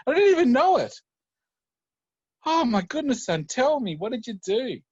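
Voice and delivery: rising to a high, loud astonished cry; amazed father. Acted. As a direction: build from disbelief to wonder. Father stunned by son's genius.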